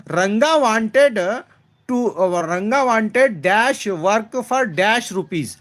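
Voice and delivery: very slow, word-by-word reading of the exercise; slow read-aloud. Shown as a demonstration. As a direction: read the sentence out slowly for the class. Teacher reads exercise sentence aloud.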